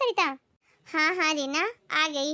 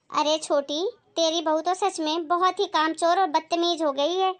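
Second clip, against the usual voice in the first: normal talking voice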